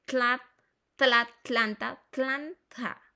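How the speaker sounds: "tla-, tlanta" broken out with both Ts clear; both Ts sounded. Shown as a demonstration. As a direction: slowly spell out the sound of "tlanta", hitting each T. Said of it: "Son dos T's".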